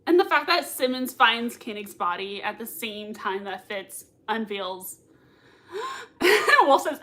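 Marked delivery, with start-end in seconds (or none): 5.7-6.6 sharp high gasp; shocked gasp. Real, not acted. Shocked by reveal.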